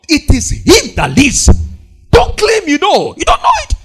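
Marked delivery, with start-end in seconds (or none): 2.1-3.8 rising preacher rebuke. Acted. Preacher warning the congregation not to claim knowledge, rising sharply. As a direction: pitch climbs steeply on you.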